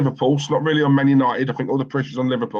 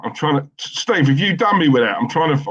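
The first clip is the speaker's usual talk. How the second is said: ordinary delivery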